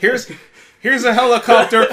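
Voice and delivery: high, very loud announcement; hammed-up blaring action-movie line; mock movie shouting. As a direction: yell it over a deafening soundtrack. Mocking how loud the film was.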